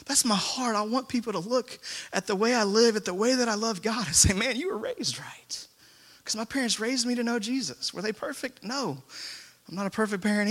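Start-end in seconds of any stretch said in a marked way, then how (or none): 4.3-5.7 slow, hushed whisper; whispered praise. Retold or imitated. Pastor whispers the words he hopes people say about him. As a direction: breathe it out slowly like a treasured compliment.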